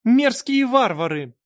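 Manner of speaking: high, shrill-free ringing angry shout. A narrator's reading. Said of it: "сердитый звенящий голос".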